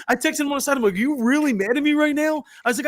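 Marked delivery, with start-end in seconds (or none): 0.9-2.4 high-pitched, retold disbelief; incredulous plea. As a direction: act out a high, pleading protest. Acting out his plea by text.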